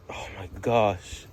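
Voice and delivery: slow, hushed oh my gosh; breathless awe. Real, not acted. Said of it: "trying to catch my breath".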